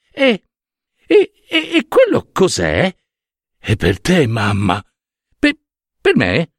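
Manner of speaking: stammer, caught off guard by the gift; Hera, stunned. Acted.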